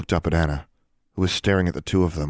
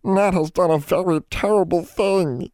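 high, distraught elf moan; keen it in a high little creature voice; mounting high-pitched elf lament; moaning house elf; 13 semitones higher than usual. A narrator's reading. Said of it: "Nat moaned, rocking back and forth again".

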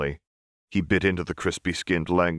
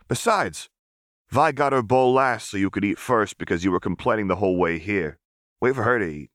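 put-on higher, bossy older boy; scolding kid; 4 semitones higher than usual. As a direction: set him straight. Clagger scolds Milo.

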